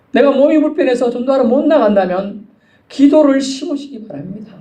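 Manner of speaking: high-pitched, urgent pulpit delivery; fervent preaching. Real, not acted. Preacher testifying to urgent prayer for missions, fervent preaching.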